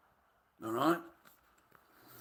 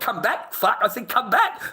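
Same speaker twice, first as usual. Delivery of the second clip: taunting the dog; 9 semitones higher, 4 dB louder than usual. Retold or imitated.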